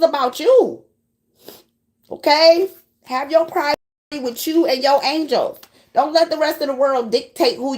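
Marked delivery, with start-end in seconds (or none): none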